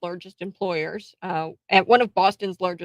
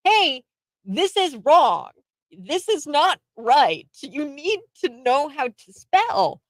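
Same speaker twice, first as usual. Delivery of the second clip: higher and slow, correcting each word; lightly voiced stern teacher; 7 semitones higher, slower than usual. Teacher who should have corrected the student, lightly voiced. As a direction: lay it out slowly like a lecturing teacher.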